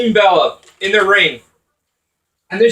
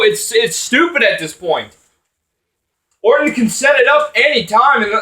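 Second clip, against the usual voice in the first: normal talking voice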